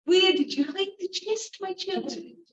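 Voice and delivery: put-on high, sweetly cunning old woman; sly old woman. Old woman coaxing the servants, high and sly. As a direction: coax the secret out.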